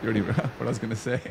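can't get it out without giggling; laughing. Real, not acted. Interviewer cracking up privately over the coming word, laughing. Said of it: "laughing to myself here".

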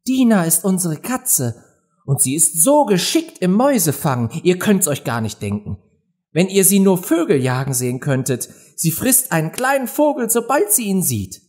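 high, enthusiastic little-Alice voice; eager. A narrator's reading. Alice gladly tells of her favourite pet, eagerly. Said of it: "Alice antwortete eifrig".

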